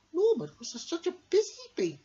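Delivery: high, fast, wistful little bee; wistful Amelia. Acted. Amelia sighs, missing Norman the bee. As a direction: sigh fondly for the lost friend. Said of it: "sighed Amelia".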